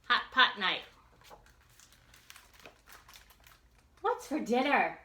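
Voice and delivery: loud, lively read-aloud with slow punchy refrains; reading to children. A narrator's reading. Teacher reading a picture book to children, lively and loud. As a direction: read it big and bouncy for the kids.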